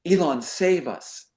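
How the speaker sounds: usual speaking voice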